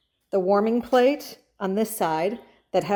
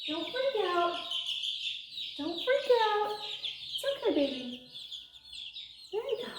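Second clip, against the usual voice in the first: high, soft soothing coo; calm the panicking chicks; 10 semitones higher, 7 dB quieter than usual. Real, not acted.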